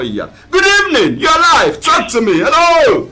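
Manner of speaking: high, drawn-out host call; high-pitched, showy phone-in welcome; hyped radio greeting. Real, not acted. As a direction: greet the caller with big rising energy. Host calls out to phone caller.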